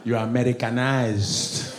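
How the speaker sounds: put-on slow Nigerian-accented accusation. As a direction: draw it out in a mock Nigerian accent. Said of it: "Even Nigerians be testing me".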